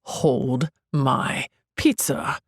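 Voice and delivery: slowed right down, every word spaced; a slow, pointed repeat of the request; slow, deliberate repeat. Acted. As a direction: spell it out slowly, dead serious.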